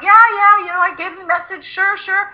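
high, sheepish, overly agreeable; mock meek. Agent to his boss Richard, high and sheepishly agreeable. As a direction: play the nervous yes-man.

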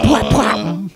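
vocal bass plucks. Pretend. Imitating bass notes.

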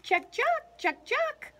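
high loud chuck calls; high monkey chatter. A narrator's reading.

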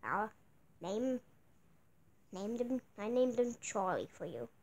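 ordinary talk, nothing marked

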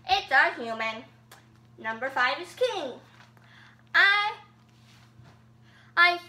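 emphatic sing-song flower character voice; Flowey. Acted. Host as Flowey redoes other characters' lines, put-on cartoon voice. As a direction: play a talking flower reciting everybody's lines. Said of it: "all the other voices in Flowey voice".

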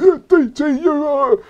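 high-pitched imitation of a mouthy fan. Imitating people at a game giving it mouth, mock jeer. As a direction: mimic a gobby fan jeering, not fully shouted. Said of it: "there'll be people".

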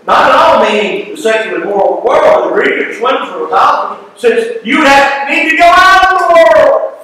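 from emphatic reading to a high chanted peak; building to a cry. Acted. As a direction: preach it harder line by line until it rings out. Reading Paul with rising force.

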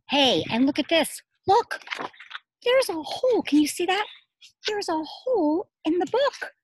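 high, loud, eager pointing out; excited discovery. Real, not acted. Teacher to children over a picture book, excited.

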